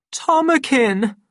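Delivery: very high, loud, drawn-out call; high pleading wail; grief-stricken cry. A narrator's reading. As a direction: cry out the name in anguish. Linda's grief-stricken appeal to him.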